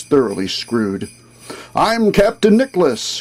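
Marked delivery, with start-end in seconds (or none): 1.8-3.2 higher-pitched, mildly proud announcement; rather proud. A narrator's reading. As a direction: introduce yourself with a touch of pride. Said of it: "he then said rather proudly".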